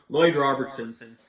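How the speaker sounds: usual speaking voice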